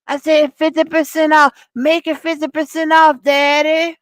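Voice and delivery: mock him pushing a sweatsuit sale; a put-on pitchman voice selling sweatsuits; imitating his sales pitch. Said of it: "That's what he said".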